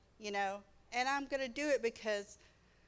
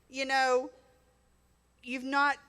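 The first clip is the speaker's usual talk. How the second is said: ordinary talk, nothing marked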